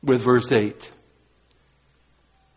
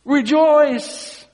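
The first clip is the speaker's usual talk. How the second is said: higher, louder burst of "Rejoice!"; joyful shout; 12 semitones higher, 4 dB louder than usual. Real, not acted. Preacher bursting out to the congregation, joyful shout. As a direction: cry it out after the hush. Preacher calls out after quiet build.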